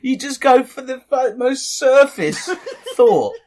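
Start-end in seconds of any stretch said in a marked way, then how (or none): 1.4-3.5 slow, high, breaking into laughter; laughing. Real, not acted. Listener teasing the storyteller's obvious pun, laughing. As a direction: crack up while mocking.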